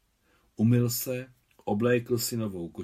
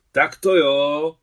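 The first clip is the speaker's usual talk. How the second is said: put-on high, loud, drawn-out boyish cheer; merry, raised-voice agreement; cheerful shout; 5 semitones higher, 12 dB louder, much slower than usual. A narrator's reading. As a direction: call it out gleefully, stretching the words. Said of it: "křikl Miťka zvesela".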